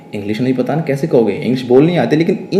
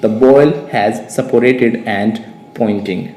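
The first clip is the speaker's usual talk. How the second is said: very slow, deliberate English for learners; measured dictation pace; slow model sentence; 2 semitones lower, much slower than usual. Shown as a demonstration. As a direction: lay out each word, pausing before the last. Teacher models the full sentence slowly.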